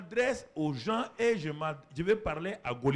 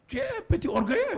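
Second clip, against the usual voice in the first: Eliab's scornful jab, pitched way up; mocking Eliab; 3 dB louder than usual. Preacher mimics Eliab scorning David. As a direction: sneer it like a jealous big brother. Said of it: "il dit, mais tu es orgueilleux".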